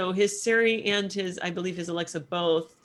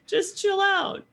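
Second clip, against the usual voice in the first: high, loud mock alarm; startled protest; 13 semitones higher, 7 dB louder, slower than usual. Woken sleeper answering the alarm, loud startled pleading. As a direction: protest as if rudely woken, pitched way up. Acting out being startled awake.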